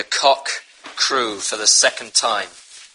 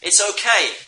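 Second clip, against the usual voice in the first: high, louder mock of a shrugging sinner; careless excuse; 7 semitones higher, 4 dB louder than usual. Preacher mocking a careless believer, shrugging. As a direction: brush off the sin lightly, higher pitched. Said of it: "We shouldn't say".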